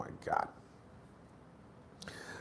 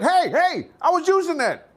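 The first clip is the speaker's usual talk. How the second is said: annoyed gym guy; 12 semitones higher than usual. Retold or imitated. Gym guy claiming his equipment, indignant high protest. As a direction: snap that you were using it.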